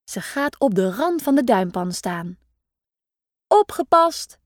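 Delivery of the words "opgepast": calling out. A narrator's reading. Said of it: "roept ze".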